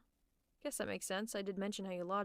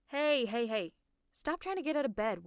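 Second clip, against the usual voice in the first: raised, louder urgent protest; higher, insistent telling-off; alarmed scolding; 3 semitones higher, 5 dB louder than usual. Acted. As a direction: call out sharply to stop them. Stopping patient getting out of bed.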